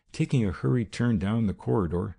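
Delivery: ordinary delivery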